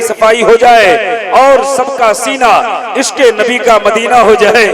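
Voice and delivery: loud, measured Sanskrit-style oratory; booming ceremonial welcome; full-force preaching. Real, not acted. Preaches in Sanskritised Hindi to crowd.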